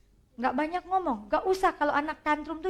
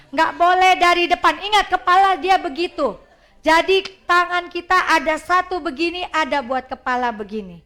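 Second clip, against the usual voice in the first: 1 emphatic instruction; 3 semitones higher, 8 dB louder than usual. Real, not acted.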